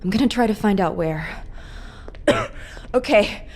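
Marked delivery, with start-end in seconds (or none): 1.5-2.9 harsh unvoiced coughs; sick coughing. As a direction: cough through the sickness. Infected Leon winding down.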